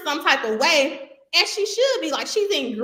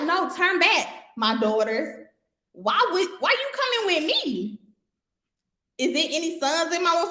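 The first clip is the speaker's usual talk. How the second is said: sassy Naomi; 4 semitones higher than usual. Retold or imitated. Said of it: "she's getting real, sassy".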